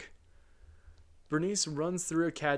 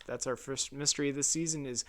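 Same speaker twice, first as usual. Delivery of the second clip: normal talking voice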